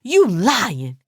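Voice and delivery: restrained angry accusation; a mild, understated roar. A narrator's reading. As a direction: accuse her with a touch of anger. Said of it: "she roared, her angry voice".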